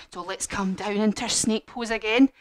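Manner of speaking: plain reading voice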